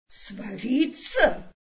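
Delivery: drawn-out, astonished question; amazed cry. A narrator's reading. Elephant echoes the threat to his speck, amazed. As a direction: stretch the word slowly in disbelief. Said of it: "Слон Хортон вскричал в изумлении".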